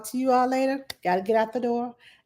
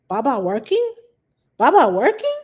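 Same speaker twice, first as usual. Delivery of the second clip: put-on toddler question inflection; 3 semitones higher, 6 dB louder than usual. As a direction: imitate a toddler asking, building up. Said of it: "she's starting to get inflections in her voice".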